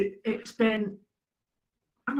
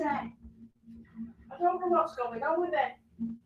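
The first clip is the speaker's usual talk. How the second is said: ordinary delivery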